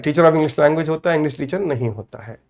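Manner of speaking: ordinary talk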